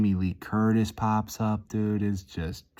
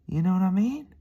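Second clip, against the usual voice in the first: hyped squeal; 12 semitones higher, 4 dB louder than usual. Acted. Hyped gym rant.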